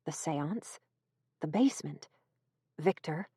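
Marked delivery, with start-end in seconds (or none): none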